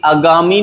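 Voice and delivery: आगामी said as 'a-gaamee'; wrong short start. Shown as a demonstration. Teacher rejects a common error, आगामी with short first a. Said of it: "नहीं होता".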